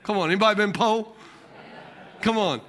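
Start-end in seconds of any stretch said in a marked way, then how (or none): none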